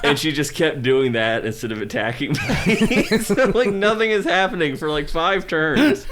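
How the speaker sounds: high, loud words through laughter; can't stop laughing while telling it; laughing hard. Real, not acted. Guest describing her partner's game tactic, laughing hard.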